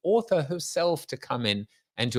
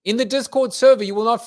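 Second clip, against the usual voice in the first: excited plug; 8 semitones higher, 8 dB louder, faster than usual. Real, not acted.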